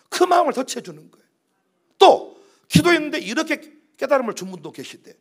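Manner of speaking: ordinary talk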